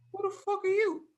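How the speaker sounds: very high-pitched imitated challenge; mock confrontation. Homeowner challenging a stranger, high-pitched mock confrontation. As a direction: shoot the question up high, imitating disbelief.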